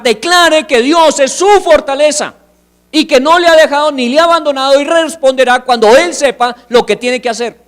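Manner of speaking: full-force sermon climax; fiery preaching. Acted. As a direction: preach it hard to the congregation.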